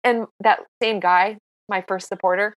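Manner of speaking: ordinary talk, nothing marked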